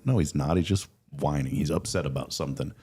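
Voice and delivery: ordinary talk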